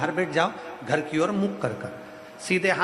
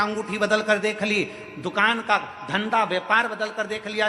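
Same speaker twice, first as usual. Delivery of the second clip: higher-pitched rhythmic sermon list; preaching litany; 5 semitones higher than usual. Acted. Preacher listing every failed remedy to troubled devotees, higher-pitched preaching litany. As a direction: preach it as a relentless, repetitive litany.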